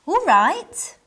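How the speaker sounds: "All right" bursting with eagerness at an invitation; eager all right. Shown as a demonstration. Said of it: "Intonation can change the meaning".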